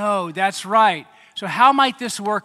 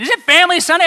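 higher and louder, eager Family Sunday question; excited question; 10 semitones higher, 10 dB louder, much faster than usual. Retold or imitated. Wife asking preacher about Family Sunday, excited. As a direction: ask it bursting with excitement. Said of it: "Catherine and I were talking".

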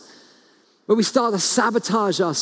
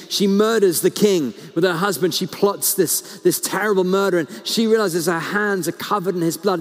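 usual speaking voice

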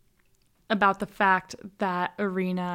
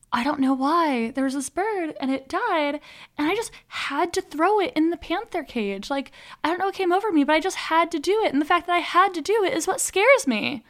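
put-on light French accent, higher and a little quick; 7 semitones higher than usual. Podcaster retelling Irena's confession to Oliver, in a light French accent. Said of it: "But with a really cute French accent".

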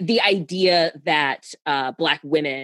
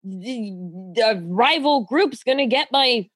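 mock caveman panic; 5 semitones higher, slower than usual. Mocking primal fear of rivals.

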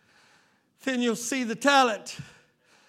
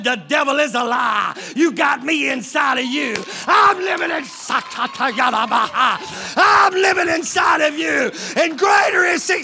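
God declaring; 2 semitones higher, 4 dB louder than usual. Retold or imitated. Preacher voicing God with a loud shouted declaration. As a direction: thunder it as God's voice. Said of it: "and said".

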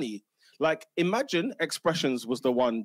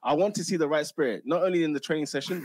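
ordinary delivery

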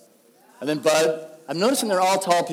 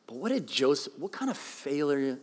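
ordinary talk, nothing marked

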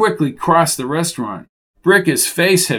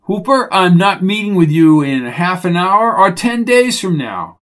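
raised, firm exasperated voice; annoyed, higher and louder retort; irritated refusal; 4 semitones higher, 5 dB louder than usual. A narrator's reading. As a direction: shut the caller down firmly. Jones refuses Hooper on the phone.

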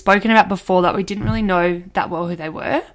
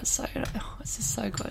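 whispering; 17 dB quieter than usual. Real, not acted. Gushing about the show.

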